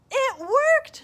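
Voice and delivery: high, loud delighted cry; excited. Acted. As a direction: burst out happily. Bear delighted.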